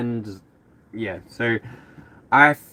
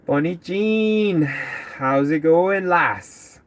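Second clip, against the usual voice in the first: slow, higher mock Irish-Scottish lilt; playful Celtic accent; 6 semitones higher, much slower than usual. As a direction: greet her in an imagined Celtic accent, drawing it out. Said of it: "I end up hearing people's accents".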